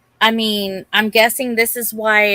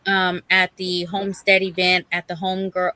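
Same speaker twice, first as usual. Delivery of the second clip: ordinary talk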